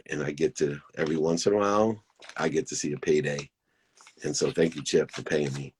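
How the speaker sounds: ordinary talk, nothing marked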